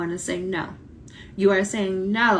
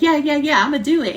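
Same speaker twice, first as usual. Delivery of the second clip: eager student; 7 semitones higher, 6 dB louder than usual. Retold or imitated. Eager student agreeing again.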